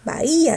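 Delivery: BAHÍA with the I high and strong; stressed I. Shown as a demonstration. Teacher confirming with the class, stressed I in Bahía. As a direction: punch the I in "Bahía". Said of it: "la que va a tener la fuerza es la cerrada".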